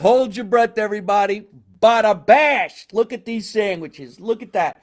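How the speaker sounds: high, loud, stretched-out hype; excited showman's reveal. Acted. Host unveils sandwiches to viewers, excited showman's hype. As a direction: build up the big reveal with booming delight.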